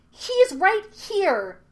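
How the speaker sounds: put-on high, drawn-out boyish shout; loud indignant yell in a boy's voice. A narrator's reading. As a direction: shout it slowly, pitch leaping up. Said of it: "shouted Aang".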